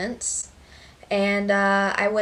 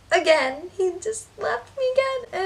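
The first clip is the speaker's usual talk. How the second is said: higher, half-crying complaint; a touch of tears; 10 semitones higher than usual. Retold or imitated. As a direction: let the voice crack a little as it goes up. Dad leaving, cry heard.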